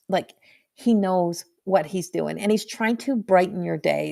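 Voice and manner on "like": quiet chuckle. Retold or imitated. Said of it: "under his voice kind of chuckle".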